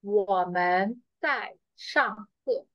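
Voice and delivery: slow, careful demo for students to repeat; slow model sentence. Shown as a demonstration. Teacher modelling a sentence slowly for the class to repeat. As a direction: say it slowly so the class can copy. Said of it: "So we will say".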